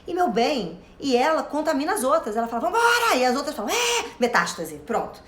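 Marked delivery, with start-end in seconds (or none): 2.6-3.2 high, excited call to arms; mock battle cry of a cell; rallying cry. 3.7-4.0 high, excited yell of agreement; cheer. Retold or imitated. Other cells cheer.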